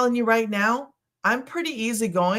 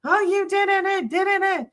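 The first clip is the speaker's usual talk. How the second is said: much higher, put-on whiny accuser; mock complainer; 11 semitones higher than usual. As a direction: imitate a customer's complaint in a silly high voice. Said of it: "when somebody's like".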